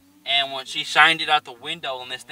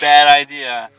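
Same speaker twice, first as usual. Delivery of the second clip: much louder, punched BAD; emphatic warning; 12 dB louder, much slower than usual. Warning against bringing only girls.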